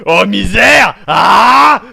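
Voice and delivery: loud exasperated yell; frustrated shout. Real, not acted. Host yelling after another failed take. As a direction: let the frustration burst out.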